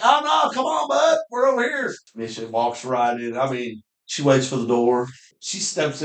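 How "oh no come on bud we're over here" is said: high, coaxing call to the dog; teasing call. Retold or imitated. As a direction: call her over sweetly high. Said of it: "I'm like".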